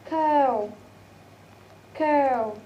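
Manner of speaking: "cow" drawn out with falling pitch; example word demo. Shown as a demonstration. Teacher giving an example word, drawn-out pronunciation demo. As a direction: model "cow", gliding through the vowel. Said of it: "as in the word of".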